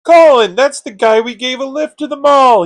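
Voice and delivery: loud, high cry of recognition; alarmed realization. Acted. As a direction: exclaim in shock at spotting the culprit. Recognizing the thief on camera.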